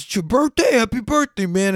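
excited driver. Retold or imitated. Driver reacts to birthday tip.

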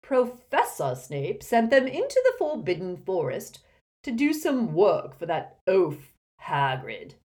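loud, high, pompous explanation; sneering headmaster, projected; haughty portrait, disdainful. Acted. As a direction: inform them with lofty disdain.

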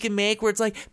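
normal talking voice

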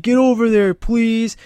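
high, loud fake-menacing order with a polite tail; mock tough guy. Rex teaching Pip to talk nasty, a high mock tough-guy bark. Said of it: "talk nasty".

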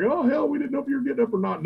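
high, fast teasing imitation; mocking teammates. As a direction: mimic them ribbing him. Imitating other teams teasing him.

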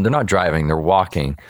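ordinary delivery

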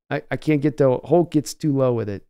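ordinary talk

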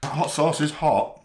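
talking with a mouth full; words round a bite of food. Real, not acted. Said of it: "Talking with my mouth full".

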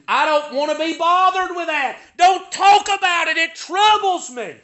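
high, forceful mock protest; acted-out objection, pitched high; agitated refusal. Voices hearers refusing the message.

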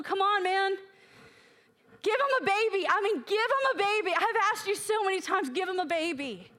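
high, pleading protest; worked-up, incredulous nagging; exasperated demand. Retold or imitated. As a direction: badger them in acted-out frustration. Acts out badgering God in frustration.

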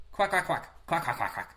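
playful quacking like a duck. Pretend.

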